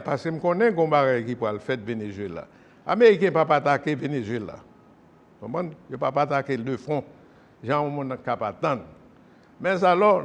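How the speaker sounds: ordinary talk, nothing marked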